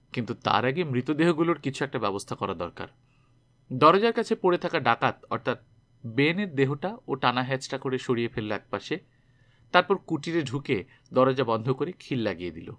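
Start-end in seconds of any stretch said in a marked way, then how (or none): none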